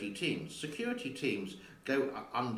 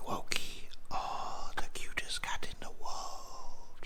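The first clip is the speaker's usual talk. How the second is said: whispering to the cat. Real, not acted. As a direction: whisper sweet nothings to a sleepy cat. Cooing to the cat.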